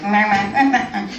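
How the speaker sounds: repeated imitation quacks; duck-quack tic. Comic imitating noises heard from the living room, quacking. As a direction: bleat out quacks like an odd duck. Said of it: "quack, quack sounds".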